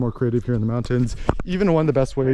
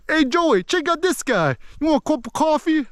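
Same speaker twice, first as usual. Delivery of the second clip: hammed-up Boston accent, much higher; playful Boston guy impression, pitched way up; 12 semitones higher than usual. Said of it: "talking like that".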